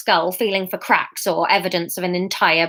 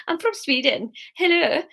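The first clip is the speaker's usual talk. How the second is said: testing out a Swedish accent; Swedish accent attempt; 7 semitones higher, 3 dB quieter than usual. Shown as a demonstration. Narrator practises being from Sweden, Swedish accent attempt. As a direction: try on a wobbly Swedish lilt. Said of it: "How did they all talk?".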